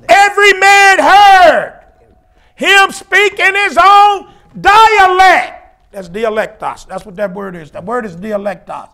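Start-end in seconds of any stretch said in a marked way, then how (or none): 0.1-5.9 slow, high shout, each phrase stretched; full-force preaching shout. Real, not acted. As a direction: thunder the verse out slowly, pausing midway. Preacher repeats verse at full force.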